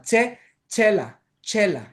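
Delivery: ΤΣΕΛΑ with thick ch and e; first vowel as e. Shown as a demonstration. Teacher showing a variant heard sometimes, e vowel. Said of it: "το πρώτο σαν".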